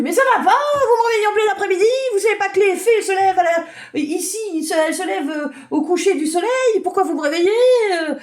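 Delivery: put-on a cranky fairy, rudely woken, yells at the kids; grumpy fairy yelling. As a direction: scold them like a furious little fairy. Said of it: "elle te crie dessus".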